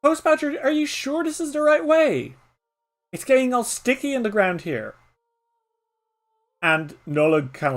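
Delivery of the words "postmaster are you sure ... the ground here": put-on much higher young voice; higher child asking nervously; young Patter. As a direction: sound like an unsure child. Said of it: "hear Patter's voice".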